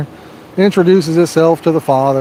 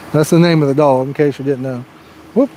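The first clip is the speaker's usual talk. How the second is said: normal talking voice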